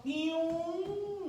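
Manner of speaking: very slow, high boing; playful sound effect; cartoon boing. Shown as a demonstration. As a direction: make a cartoon spring sound. Sound effect for total reflection.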